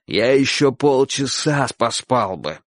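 slow sleepy grumble; irritated. A narrator's reading. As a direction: complain slowly about being woken. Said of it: "раздражённо сказал мужчина". (раздражённое ворчание)

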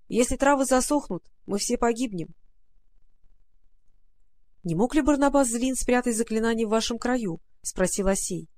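ordinary delivery, nothing marked

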